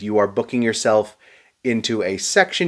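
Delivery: ordinary delivery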